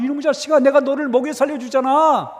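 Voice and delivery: high, loud scolding of a son; angry father. Retold or imitated. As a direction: act out an exasperated father's outburst. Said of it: "우리 아버지가 화가 나가지고".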